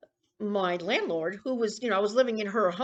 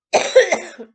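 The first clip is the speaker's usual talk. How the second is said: harsh real coughing fit; choking cough; 9 semitones higher, 14 dB louder than usual. Real, not acted. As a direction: cough hard, choking on milk. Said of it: "That's why I'm choking".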